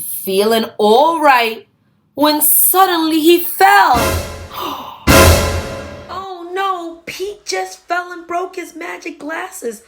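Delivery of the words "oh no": ordinary delivery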